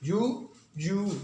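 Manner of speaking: यू drawn out very slowly; बहुत धीमे लंबी यू; slow long U. Shown as a demonstration. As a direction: stretch each यू. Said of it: "long sound है वो भी यू ही है".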